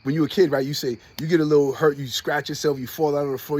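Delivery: ordinary talk, nothing marked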